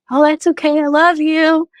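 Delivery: high, bright happy voice; joyfully voiced husband on the phone. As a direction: lift it way up, joyful. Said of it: "And he goes".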